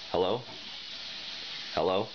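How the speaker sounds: ordinary delivery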